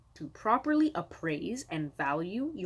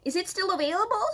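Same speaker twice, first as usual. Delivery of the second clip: mock buyer's message; 11 semitones higher, faster than usual. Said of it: "message you and say".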